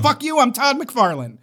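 cocky Boston brag; Boston accent. Retold or imitated. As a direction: sneer it in thick Boston. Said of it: "imagine I said that in a thick Boston accent".

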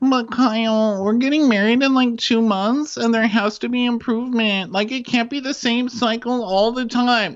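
high, loud exaggerated whine; high-pitched put-on girlfriend nagging; mock pleading. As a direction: plead at the top of the register, hammed up. Acted quarrel, Amanda to Kyle.

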